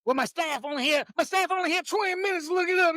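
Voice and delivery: high, fast mimicking whine; hammed-up imitation of a politician's excuse; mocking quote. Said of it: "trying to state that".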